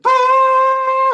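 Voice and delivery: high shrieking steam-whistle noise. Retold or imitated. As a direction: let the steam scream out like a cartoon kettle. Yosemite Sam steam blowing.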